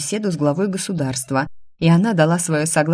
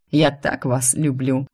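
tender father; 3 semitones lower, slower than usual. A narrator's reading.

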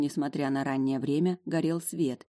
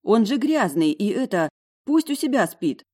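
high, loud, indignant protest; indignant roommate; 6 semitones higher, 6 dB louder than usual. A narrator's reading. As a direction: object loudly in a high voice. Roommate protests about the dirty dog. (возмущённый протест высоким голосом)

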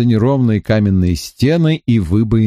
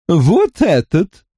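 Kossil's voice; 7 semitones higher, slower than usual. A narrator's reading. Kossil points out the key.

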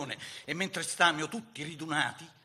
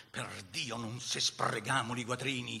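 ordinary delivery

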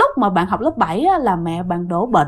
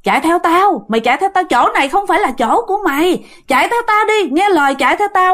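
lightly acted grandmother's command, pitched higher; retold urgent order, high and pressing; urging grandmother; 6 semitones higher than usual. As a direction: insist they follow you, voice lifted. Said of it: "bà nội nói rằng".

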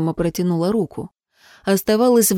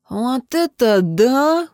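slow, higher wow; impressed amazement; 4 semitones higher, much slower than usual. A narrator's reading. Friend seeing the newcomer at the fire, amazed. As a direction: draw it out, amazed. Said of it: "присвистнул другой".

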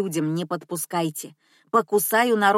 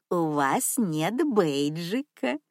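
put-on purring old lady; 3 semitones lower, slower than usual. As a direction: purr it sweetly, like a rich grandma. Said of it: "промурлыкала старушка".

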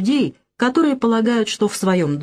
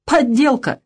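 higher, emphatic dismissal; scornful verdict; 6 semitones higher, slower than usual. A narrator's reading. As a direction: throw it out as a final accusation. (презрительно, как приговор)

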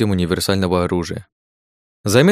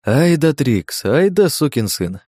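delighted; 5 semitones higher, slower than usual. Acted. Delighted praise after hack.

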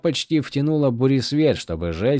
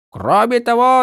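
hammed-up sergeant bark on Кроме того; mock drill sergeant; 12 semitones higher, 7 dB louder than usual. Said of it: "стала точной копией Каладина". (пародия на сержанта: Кроме того)

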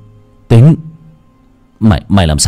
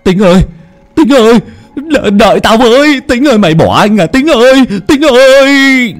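high, louder, stretched frantic calls; desperate calling out; 5 dB louder than usual. Acted. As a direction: yell after your brother who left you behind. Older brother calling after fleeing Tính.

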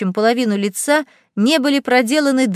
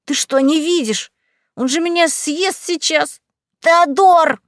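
panicked shout; 6 semitones higher than usual. Acted. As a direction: yelp for help, trapped. Squeezed bat panics, calls out.

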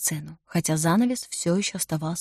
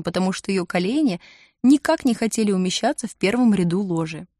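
plain reading voice

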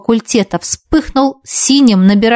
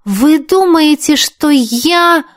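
stunned disbelief; 6 semitones higher, much slower than usual. Acted. Heroine realizes the accusation.

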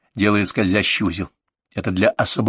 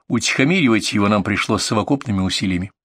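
ordinary delivery, nothing marked

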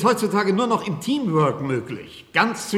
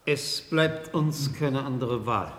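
slow, low and quiet, resigned; quiet resignation; 5 semitones lower, 6 dB quieter, slower than usual. Acted. Doctor cornered by reporters, answering quietly and resigned. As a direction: let it drop out of him with no fight left.

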